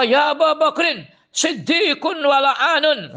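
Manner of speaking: quoted Prophet scolding lightly; voiced Prophet. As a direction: chide a dear friend, teasingly. Prophet voiced rebuking Abu Bakar.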